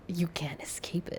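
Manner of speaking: a hushed, whispered doom warning; mock whisper. S0 whispering to S1 that consumerism can't be escaped. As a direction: whisper it like a dark secret.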